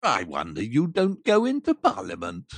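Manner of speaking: low, mocking aside; curmudgeon's dry jibe; sarcastic miser. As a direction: sneer it in a deep voice. Scrooge mocks nephew's speech.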